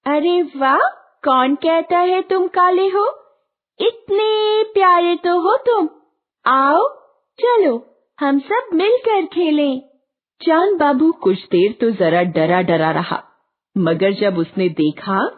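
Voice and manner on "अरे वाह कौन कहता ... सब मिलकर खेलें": put-on very high, coaxing child voice; affectionate children. A narrator's reading. Fairy children inviting a shy boy to play, affectionately. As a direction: coax sweetly in a high-pitched voice. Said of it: "प्यार से कहने लगे".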